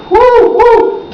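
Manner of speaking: high whooping cheer; woo cheer. Acted. Singer whooping to crowd mid-song, high cheer.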